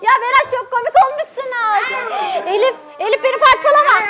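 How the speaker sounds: ordinary delivery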